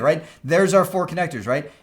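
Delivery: ordinary talk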